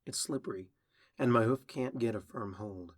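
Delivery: plain reading voice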